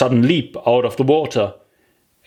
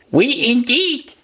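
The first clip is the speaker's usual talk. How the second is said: higher, squeaky indignant cry; mouse's outburst; 13 semitones higher than usual. Acted. The mouse crying out at Alice, squeaky indignation. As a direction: snap back in a tiny voice. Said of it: "cried the mouse".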